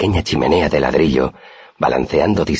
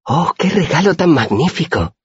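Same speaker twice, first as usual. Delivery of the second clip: very high-pitched gleeful wonder; delighted exclamation; 14 semitones higher than usual. A narrator's reading. Auri receives honey wine as a gift, high-pitched delight. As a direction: marvel at it in a high little voice.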